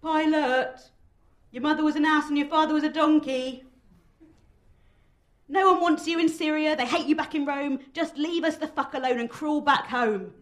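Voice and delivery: loud, high-pitched heckling; shouted taunts, rough and scornful; ugly crowd jeer. A narrator's reading. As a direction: jeer up at him from an angry mob. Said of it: "grows a little ugly in their chanting and their jeers".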